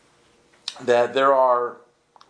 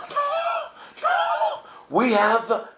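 high-pitched cry of refusal; mock wailing protest; much slower than usual. Bus mates refusing to dump their rocks, high mock wail. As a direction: wail it out in exaggerated dismay.

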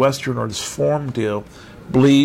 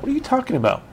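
mock incredulous; 12 semitones higher, much faster than usual. Listeners' imagined reaction.

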